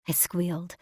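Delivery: ordinary delivery, nothing marked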